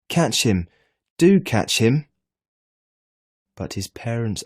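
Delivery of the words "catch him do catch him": higher, louder, desperate yell; frantic shout. A narrator's reading. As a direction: push the shout to its peak. Boy shouting at reflection.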